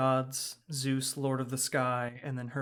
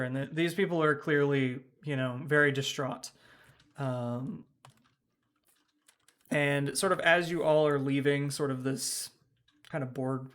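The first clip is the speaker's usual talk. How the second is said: ordinary delivery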